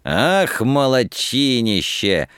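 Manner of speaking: high, drawn-out delighted cheer; joyful exclamation. A narrator's reading. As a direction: exclaim with joy, stretching the praise. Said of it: "радостно воскликнул Лихонин".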